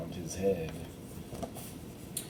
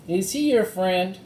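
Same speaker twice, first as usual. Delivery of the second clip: high curious question; curious girl; 10 semitones higher, 3 dB louder than usual. Acted. Annie asking Morgan about the rabbit, curious. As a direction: ask brightly.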